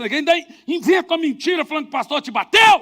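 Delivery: loud, high mock scolding; outraged wife berating. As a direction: tell him off indignantly.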